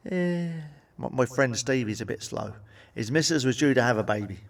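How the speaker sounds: ordinary talk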